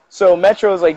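ordinary talk

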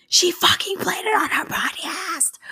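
can't believe it happened, almost shrieking; excited half-shout. Real, not acted. Her voicemail got played.

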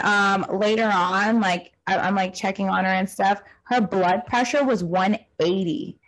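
ordinary talk, nothing marked

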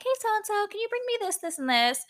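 high, light voice ordering room service; pretend sweet request. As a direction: ask in a put-on high little voice. Acts out calling room service.

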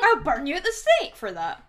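loud mock threat; mock accusation. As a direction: shout like a witch hunter. Witch hunter accusing playfully.

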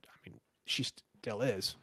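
confiding whisper; whispered aside. Real, not acted. Aside about her still being gorgeous.

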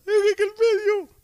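high, drawn-out mock crying; imitated tearful whining. Boy answering his stepfather, imitated high crying whine. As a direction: squeal it out loudly like a bawling child. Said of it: "¿Qué tú estás llorando?".

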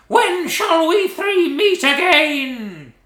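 high, slow, loud witch shriek; eldritch shriek. Acted. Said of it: "an eldritch voice shrieked".

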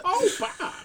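high-pitched cartoon wife, half laughing; high, laughing "Oh, Bob"; Linda. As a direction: imitate the wife on Bob's Burgers. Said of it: "she's Linda".

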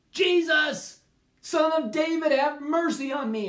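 high, loud cry for mercy; shouted plea. Acted. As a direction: shout it over the crowd, begging. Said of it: "he shouted all the louder".

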